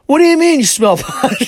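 high, loud smartass innocence, laughing; mock innocent. Son playing dumb to his mom, loud and high. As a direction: play dumb loudly through a laugh.

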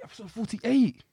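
high hyped-up announcer shout; hype announcer. Real, not acted. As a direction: call it out like a ring announcer. Repeating louder, higher, hype.

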